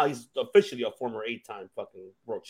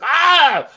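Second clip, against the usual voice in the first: short imitated yell of rage, much higher and louder; mock furious yell; 12 semitones higher, 10 dB louder than usual. Host imitating Jericho going ballistic backstage, mock furious yell. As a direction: burst out like a wrestler losing it backstage. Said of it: "Of course, he's like".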